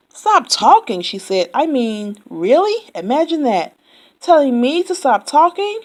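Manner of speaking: loud offended mimicry; indignant Yak. A narrator's reading. Yak mocks Camel's order, loudly offended. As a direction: huff at the nerve of it.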